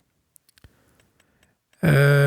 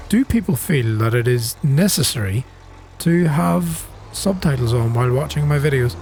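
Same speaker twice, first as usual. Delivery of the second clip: normal talking voice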